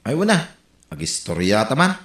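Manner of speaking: ordinary talk, nothing marked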